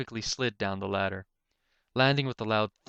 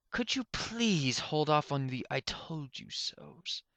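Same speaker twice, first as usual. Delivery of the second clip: strained unicorn hanging on, higher and drawn out; grunted plea; 6 semitones higher, slower than usual. Acted. Unicorn dangling from a pipe begs Suture, strained. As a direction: plead through the strain, slowly. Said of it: "grunted the unicorn".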